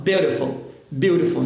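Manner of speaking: "beautiful" with T turned to a quick R tap; flap T. Shown as a demonstration. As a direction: say "beautiful" like "biurifol". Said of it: "quando ele está entre vogais, isso acontece".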